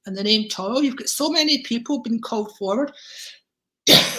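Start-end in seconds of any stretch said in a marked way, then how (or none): 3.9-4.2 a rough cough choking off speech; real cough. Real, not acted. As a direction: cough breaking into the word. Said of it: "it's very difficult to speak".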